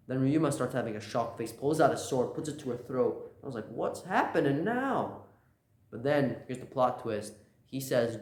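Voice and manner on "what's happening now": high, drawn-out disbelief; bewildered. Retold or imitated. Reader reacts to the sword at her throat, bewildered. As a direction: stretch it out in mock astonishment. Reacting to shocking plot turn.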